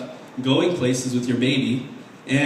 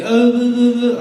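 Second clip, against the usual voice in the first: put-on high, loud "uh-uh-uh" baby noise; imitated baby babble; 8 semitones higher, 7 dB louder, slower than usual. Comic mocking a baby who can't talk, imitated babbling. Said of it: "I don't make that noise".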